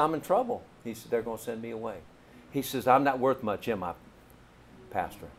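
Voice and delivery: soft, low voicing of a frightened boy; quiet sadness. Boy's despair retold softly.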